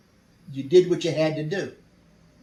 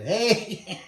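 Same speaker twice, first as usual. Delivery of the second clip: short higher-pitched call, half laughing; retold holler; 7 semitones higher than usual. Hiding prisoners call to English voices outside, retold holler. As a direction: call out, not at full volume. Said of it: "So we hollered".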